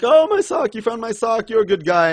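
high-pitched, hammed-up gratitude; overjoyed, as a send-up of a silly character; mock gushing. Reviewer imitating a character reunited with his sock, mock gushing.

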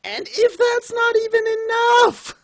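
very high, slow indignant cry; escalating exasperation. Real, not acted. Reviewer piling on the next complaint, exasperated high yell. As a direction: stretch it out at the top of the voice.

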